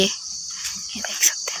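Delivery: soft, slow whisper. Real, not acted. Vlogger whispering to viewers about the cat. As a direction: hush it so the cat stays put.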